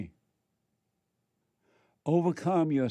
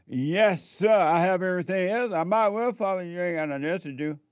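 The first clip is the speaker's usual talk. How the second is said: eager rich man; 6 semitones higher than usual. Acted. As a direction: offer yourself up, a bit too keen. Rich man answers Christ.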